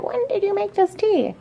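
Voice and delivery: high, soft questioning voice; imitating a puzzled visitor. Imagined person walking in.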